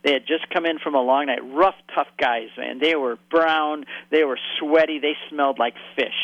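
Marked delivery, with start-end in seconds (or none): none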